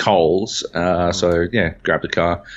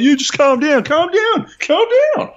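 mimicked panic, climbing to a squeal; higher and higher pitched; 4 dB louder than usual. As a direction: start high and loud, rise with each repeat. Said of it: "higher and higher pitched".

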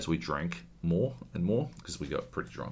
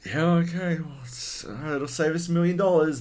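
relieved studio exec; 8 semitones higher, 4 dB louder than usual. Retold or imitated. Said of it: "they're like".

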